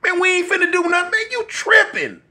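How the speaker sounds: rising pitch, fast and exasperated; begging his friend to leave, high and fast. Retold or imitated. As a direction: plead to leave, voice climbing high and quick. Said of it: "I say".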